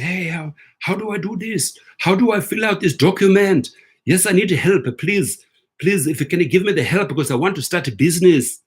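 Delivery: lightly imitated South African accent, pleading; a hint of a South African client begging for help. Vlogger imitating South African clients asking for loan help, light accent. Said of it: "that's how they speak. That's how their accent is".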